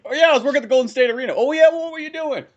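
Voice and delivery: high, fast show-off voice; hammed-up bragging party chatter; lightly voiced bragging guy; mock bragging. Mock cool salesman bragging.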